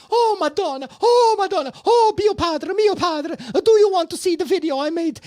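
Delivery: very high, loud Italian wailing; dramatic Italian lady. Retold or imitated. Panicked neighbor wails to them about the storm, high and loud. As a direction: wail it like an over-the-top Italian drama lady. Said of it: "this drama lady".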